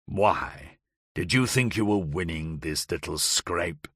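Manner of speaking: a hint of a cruel aristocrat scorning her; Howard's mocking taunt. A narrator's reading. Howard sneers at Kitty, lightly voiced low mocking taunt.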